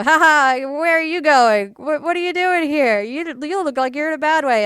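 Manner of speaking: high, loud, hearty cop laughing it off; jovial police officer. Retold or imitated. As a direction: play a cheery officer amused by a lost kid. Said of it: "was very jovially".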